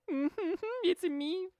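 high, drawn-out teasing hum; playful mock-coy reveal, slow and high-pitched; coy giggly greeting. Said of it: "giving, like, a".

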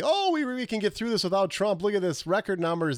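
high, mock-eager network executive; overconfident TV executives. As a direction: imitate cocky executives talking themselves up. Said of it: "N B C execs are gonna be".

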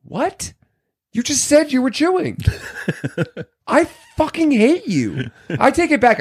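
high incredulous outburst; exasperated. Real, not acted. Outraged reaction to the movie.

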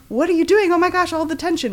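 high, loud, hurried outburst; mock-frantic protest at a cliffhanger; exasperated reader. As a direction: act out a reader agonised by suspense. Acts out a frustrated reader in suspense.

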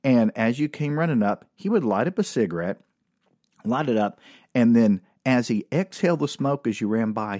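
ordinary delivery

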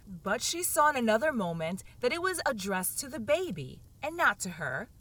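plain reading voice

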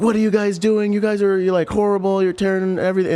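high, loud outraged father; angry Iraqi dad. Retold or imitated. Irate father yelling at soldiers kicking in his door, high and loud. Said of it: "he was just like".

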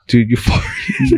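slow, very high, cracking up mid-word; laughing accusation. Retold or imitated. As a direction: burst out laughing while saying it. Telling friend she farted.

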